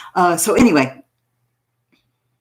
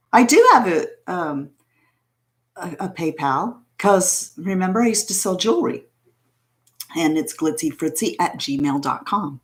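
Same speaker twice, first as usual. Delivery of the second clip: ordinary talk, nothing marked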